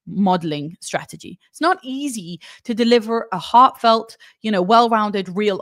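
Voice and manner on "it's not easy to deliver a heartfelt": ordinary talk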